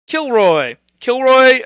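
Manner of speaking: normal talking voice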